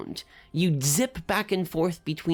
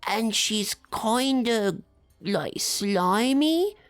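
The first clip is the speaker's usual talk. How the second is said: higher, slow gossiping caterpillar; the worm whispering about the troll bartender, pitched up and slow; slow little worm voice; 4 semitones higher, much slower than usual. Acted. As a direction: draw it out like a tiny worm sharing gossip.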